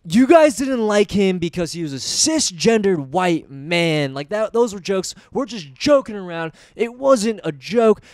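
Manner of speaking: retold indignant rant. Retells Joe's angry rant at audience.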